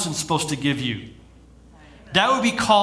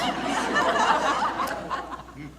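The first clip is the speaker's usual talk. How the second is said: put-on high-pitched giggle in girl voice; girly giggle; 5 dB quieter than usual. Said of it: "there's my best girl voice".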